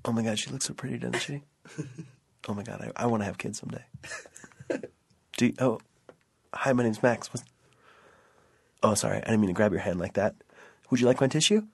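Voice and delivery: soft, low nervous voice; lightly voiced awkward guy; Max impression. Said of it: "my impression".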